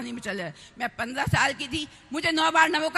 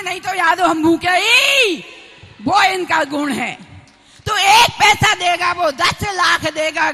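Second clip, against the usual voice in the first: loud devotional praise with drawn-out phrase ends; impassioned preaching; 2 semitones higher, 8 dB louder than usual. Real, not acted. Nun extolling her guru's virtue to devotees, impassioned preaching. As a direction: proclaim it ringingly to the crowd.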